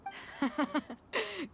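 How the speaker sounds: a short, bright chuckle on meeting; hearty laugh. Acted. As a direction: laugh warmly before the greeting. Greeting new friends cheerfully.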